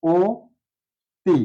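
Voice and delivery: অতি spoken as ও তি, split syllables; closed o sound. Shown as a demonstration. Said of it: "উচ্চারণ হবে".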